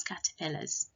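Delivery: normal talking voice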